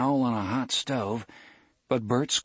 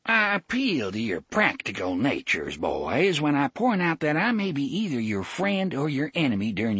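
higher, fussy small-town sheriff; pompous sheriff voice; 7 semitones higher than usual. A narrator's reading. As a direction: play a smarmy country lawman. Sheriff Avery's speech.

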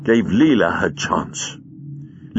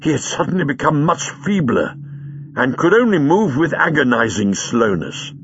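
plain reading voice

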